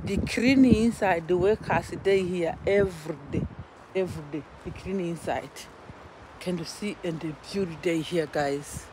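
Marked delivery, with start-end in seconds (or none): none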